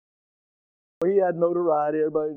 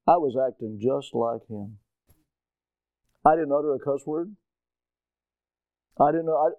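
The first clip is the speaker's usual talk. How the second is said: usual speaking voice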